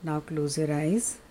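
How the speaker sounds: guided meditation. Acted. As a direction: guide them gently into stillness.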